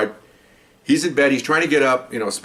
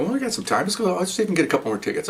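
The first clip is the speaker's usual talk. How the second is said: higher, easygoing quoted reply; casual reply; 8 semitones higher, 4 dB quieter, faster than usual. Retold or imitated. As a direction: toss it off lightly in a higher voice. Acting out his casual reply to partner.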